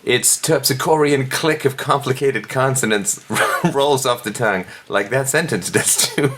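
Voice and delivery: high, laughing over the florid words; amused, overdone recital; laughing mock read. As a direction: read it while cracking up.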